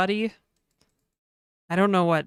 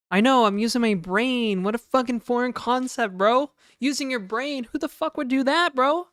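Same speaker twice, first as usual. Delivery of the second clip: sarcastic; 5 semitones higher, 5 dB louder than usual. Real, not acted. Sarcastic rant about using brain.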